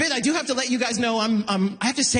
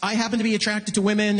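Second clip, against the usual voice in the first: ordinary delivery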